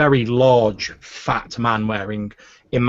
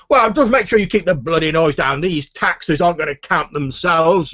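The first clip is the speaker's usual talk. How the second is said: put-on higher-pitched, irate pompous old grumbler; blustering fat official; 6 semitones higher, 4 dB louder than usual. Fat man with a cane scolds two guards over noise, indignant. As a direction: complain at the men like an interrupted official.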